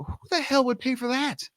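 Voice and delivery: very high, mock-incredulous; incredulous scoff. Him scoffing at friend's coaching idea, high disbelief.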